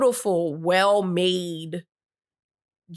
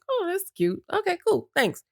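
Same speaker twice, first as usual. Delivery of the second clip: higher, light and polite; high sweet reply; 11 semitones higher than usual. Retold or imitated. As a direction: chirp it back sweetly. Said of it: "I was like".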